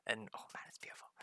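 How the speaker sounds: breathy whisper of pure delight. Real, not acted. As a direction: whisper it like a secret treat. Savouring imagined food.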